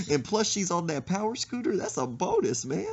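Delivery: ordinary talk, nothing marked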